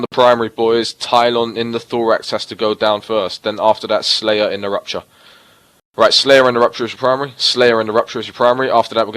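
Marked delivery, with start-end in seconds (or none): none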